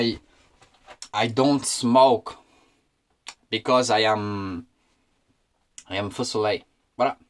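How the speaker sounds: slow, deliberate broken English; a hint of a hammed-up English speaker; mock English. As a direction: play a pompous foreigner slowly.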